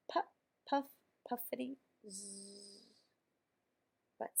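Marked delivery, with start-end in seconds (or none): 0.1-2.9 puffs fading to a very soft snore; drifting off to sleep. Acted. Tractor dozing off in his stall, puffs fading to a snore. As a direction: let the engine puffs die away into a sleepy zzz. Otis falls asleep snoring.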